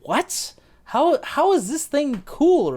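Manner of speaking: high, slow, incredulous put-on voice; mock disbelief, drawn out and high; baffled reaction. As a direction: sound utterly confused by the fashion. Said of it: "it's just like".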